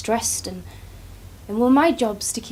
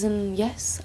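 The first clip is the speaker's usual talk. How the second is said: ordinary talk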